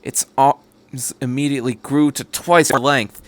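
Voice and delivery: ordinary delivery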